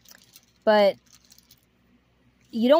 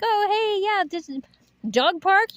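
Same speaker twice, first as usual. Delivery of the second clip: much higher excited coaxing; happy voice; 10 semitones higher, slower than usual. Shown as a demonstration. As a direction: sound thrilled for the dog. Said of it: "if you're happy".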